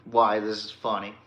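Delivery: ordinary talk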